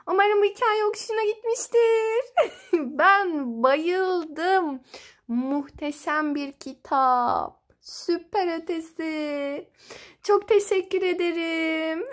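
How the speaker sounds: loud, excited rush of enthusiasm; gushing delight. Real, not acted. Storyteller thanking children after the story, loud gushing delight. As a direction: gush happily, big and loud, with a laugh.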